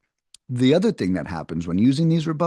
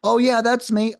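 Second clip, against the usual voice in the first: property owner answering; 10 semitones higher, 3 dB louder than usual. Retold or imitated.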